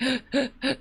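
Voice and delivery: quick sharp gasp for air; short caught breath; shallow anxious gasp. Shown as a demonstration. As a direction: show a shallow panicked inhale. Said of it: "So it's like".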